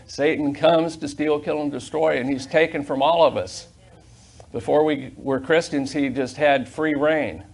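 loud, driving preacher's cadence, words hammered out; preaching at full force. Acted. Pastor rousing his church, preaching loud and hard. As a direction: preach it to the congregation, building each phrase.

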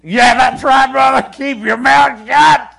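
high, loud, slow shout; loud agreement. Real, not acted. A church member shouting agreement at the sermon. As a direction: call out your amen from the pews. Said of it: "congregation agreeing loudly".

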